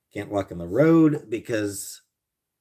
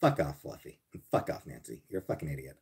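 much softer, half-whispered grumble; under-breath mutter; 7 semitones lower, 9 dB quieter than usual. Pretend. Muttering insults at chat.

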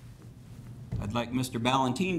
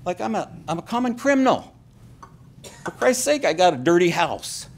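high, loud, upset protest; emotional outburst; 10 semitones higher, 5 dB louder than usual. Real, not acted. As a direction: voice climbing in exasperated indignation. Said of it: "I do get emotional about this".

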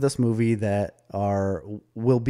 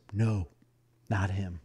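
softer mock aside to the family; quiet dismissal; 7 dB quieter than usual. Imitated dad telling his family it's someone else, quiet.

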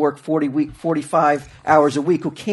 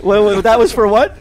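ordinary delivery

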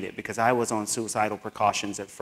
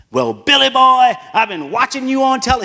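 put-on old teacher voice; 12 semitones higher, 11 dB louder than usual. Imitating the old teacher's reply.

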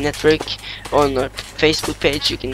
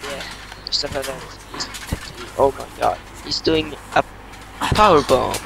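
wrestling commentator. Pretend. Kid calls the match live.

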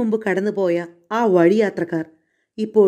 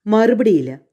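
ordinary delivery

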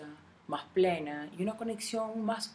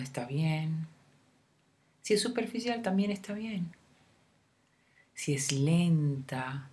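guided meditation; 4 dB louder than usual. Acted. Guide leading a listener through a love meditation, unhurried meditation voice. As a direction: lead the listener gently inward, phrase by phrase, leaving room to breathe.

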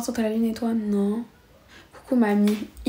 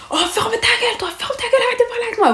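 high-pitched mock snap, much higher; retold telling off; 13 semitones higher, faster than usual. Girl retelling how she shut the boy up, high mock snap. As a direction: fire it back like the fight is on again. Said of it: "moi j'ai dit".

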